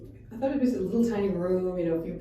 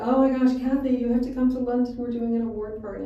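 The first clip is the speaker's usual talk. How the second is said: excited caller; 5 semitones higher, 10 dB louder than usual. Retold or imitated. Excited caller invites her to London.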